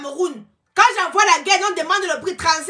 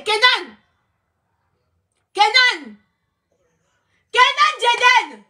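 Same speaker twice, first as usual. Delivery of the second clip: calling out; 5 semitones higher, 4 dB louder, much slower than usual. Real, not acted. Calling her child from room. (appeler l'enfant à voix forte)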